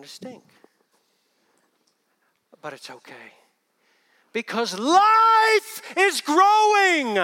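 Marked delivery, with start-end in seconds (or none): 4.3-7.2 much higher, louder, very slow proclamation; drawn-out triumphant cry; preaching shout. Real, not acted. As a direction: ring it out to the whole church. Preacher's emphatic climax.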